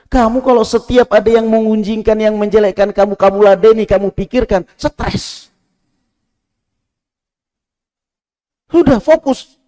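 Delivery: high, loud exhortation; preaching at full force. Acted. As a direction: urge the faithful not to complain. Exhorting the listeners.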